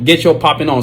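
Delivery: ordinary delivery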